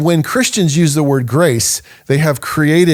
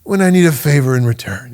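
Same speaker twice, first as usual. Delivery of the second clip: Godfather impression; 2 semitones higher than usual. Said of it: "he looks at him and says".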